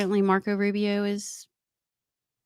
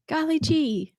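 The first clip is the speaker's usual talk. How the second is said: mock wholesome; 7 semitones higher than usual.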